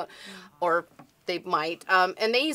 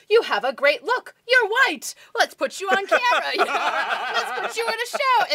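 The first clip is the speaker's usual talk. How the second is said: much higher, louder, chirpy mock producer; gushing casting agent; 11 semitones higher, 5 dB louder than usual. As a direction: oversell it like a shallow talent scout. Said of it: "they're like".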